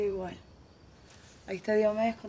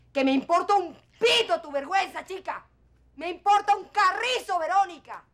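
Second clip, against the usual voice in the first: much higher and louder yelling; furious shout; 7 semitones higher, 9 dB louder than usual. Acted. Mother screams at her daughter that she doesn't care, furious. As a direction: explode in her face.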